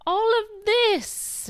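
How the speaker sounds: high, slow, grand sweeping voice; grand Andora. Retold or imitated. Retelling Andora showing off the house, grand and high. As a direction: sweep it out big and grand like a theatrical mother. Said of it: "all so big and grand as she's like".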